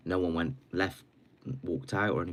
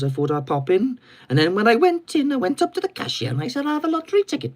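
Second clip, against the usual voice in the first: Welsh accent climbing from normal pitch to a loud squeal; rising Welsh accent; 12 semitones higher, 9 dB louder than usual. Shown as a demonstration. Telling a lottery-ticket example, Welsh accent rising ever higher. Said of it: "It starts down here and it goes up here".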